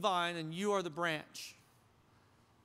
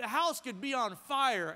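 ordinary talk, nothing marked

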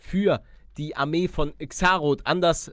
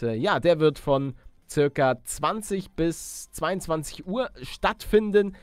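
ordinary delivery

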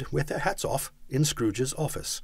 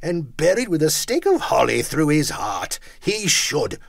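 loud, raised indignant outburst; 8 semitones higher, 4 dB louder than usual. A narrator's reading. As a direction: spit out the scorn indignantly. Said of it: "said Scrooge indignantly".

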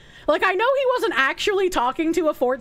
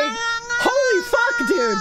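high, drawn-out exclamation; appalled disbelief; 7 semitones higher, much slower than usual. Real, not acted. Streamer reacting to creepy messages, appalled disbelief. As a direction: cry it out, high and aghast. Appalled at what was read.

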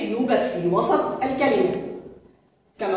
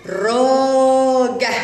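very slow, louder, every sound drawn out; stretched out for spelling; 3 semitones higher, 5 dB louder, much slower than usual. Shown as a demonstration. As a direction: draw out دراجة slowly so the long a rings. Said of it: "هيا نتهجأ". (نطق دراجة ببطء شديد مع مد الألف)

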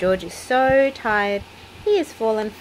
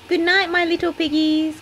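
a hint of a piggy grunt, higher; grunting goodnight; 11 semitones higher, 4 dB louder than usual. A narrator's reading. Daddy Pig says goodnight to his children, mildly grunting. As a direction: lightly grunt it like a pig dad. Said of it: "grunts Daddy Pig".

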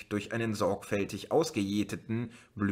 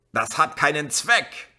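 louder, higher policeman's roar; bellowing; 6 semitones higher, 6 dB louder than usual. A narrator's reading. Policeman bellowing back at the crowd. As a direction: roar it down at them. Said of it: "brüllte der Polizist mit dem Megafon".

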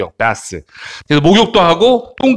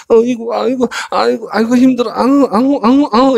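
groaning, weary grandma in a higher voice; बूढ़ी दादी; 6 semitones higher than usual. Acted. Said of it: "얘가 할머니야".

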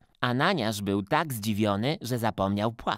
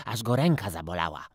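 ordinary delivery

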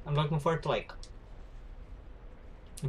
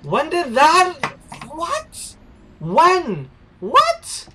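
very high, loud disbelieving cries; real startled yelps at the screen; squealing what; shocked outburst; 13 semitones higher, 14 dB louder, much slower than usual. Real, not acted. Sudden shock in game.

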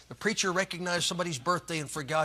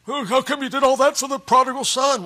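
put-on complaining older son; 8 semitones higher, 10 dB louder than usual. Older son questions father.

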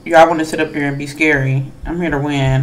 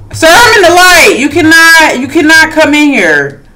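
yelling at killer; 10 semitones higher, 8 dB louder than usual. Real, not acted.

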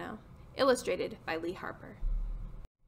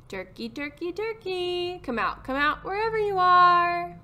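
calling out; 7 semitones higher, 4 dB louder than usual. Acted. Farmer Jake calling hidden Turkey out in the barn, singsong call. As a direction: coax it out, stretching the last words high and loud.

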